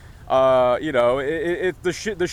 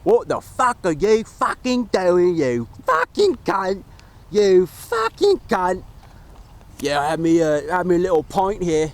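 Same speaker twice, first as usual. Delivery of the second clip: put-on sweary British accent; hammy amateur British accent. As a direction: play a foul-mouthed English guy, vowels exaggerated. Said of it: "how is my, my British accent?".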